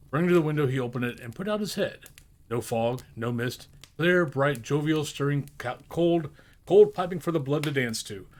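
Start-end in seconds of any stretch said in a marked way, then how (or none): none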